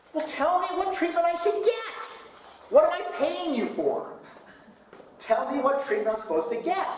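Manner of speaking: put-on high, exasperated old woman's voice; a mother demanding answers, pitched well up; frustrated mother. Mother scolding the doctor, high and exasperated. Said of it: "my mother would be so frustrated".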